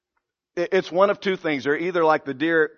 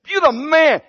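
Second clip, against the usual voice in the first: louder, higher praise; cheering teammate; 9 semitones higher, 4 dB louder than usual. Retold or imitated. Teammate congratulating the player who scored. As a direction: hail him like a hero.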